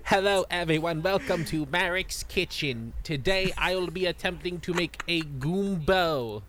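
pompous villain hosting a cooking vlog; Merrick. Acted. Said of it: "he should totally, like, become a vlogger".

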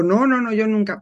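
no, no, no, más agudo y fuerte; niégalo en voz alta, exagerando; negación exagerada. Retold or imitated. Se niega a sí misma. (se imita a sí misma negándose, con voz alta y exagerada)

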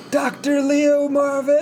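high, drawn-out mock scream; screaming a name. Host imitating Bob arriving in town screaming, drawn-out yell. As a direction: call the name out, stretched and high. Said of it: "just starts screaming".

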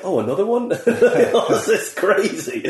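high laughing disbelief at another top mark; amazed disbelief. Retold or imitated. Student laughing at yet another outstanding grade. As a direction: squeal it, delighted and disbelieving.

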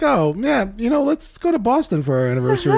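high, laughing mock of her husband; mocking spouse. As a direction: tease him in a silly high voice. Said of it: "It's just like".

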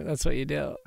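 creaky vocal fry voice. Shown as a demonstration. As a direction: drag the words into a creaky fry. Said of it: "My vocal fry".

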